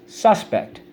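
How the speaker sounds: "Suspect" with SUS pitched high; stress on first syllable. Shown as a demonstration. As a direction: hit SUS, drop on pect. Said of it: "A person who's accused of a crime".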